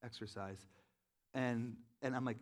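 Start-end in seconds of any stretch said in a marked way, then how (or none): 0.0-0.7 much softer, lower, hesitant ask; sheepish confession. Retold or imitated. Man asking his spiritual director for permission, sheepish. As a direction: say it like you're embarrassed to ask. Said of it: "I was almost, like, ashamed".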